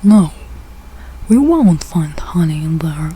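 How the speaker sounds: ordinary delivery, nothing marked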